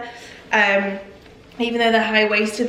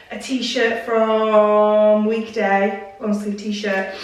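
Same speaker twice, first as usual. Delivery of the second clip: ordinary talk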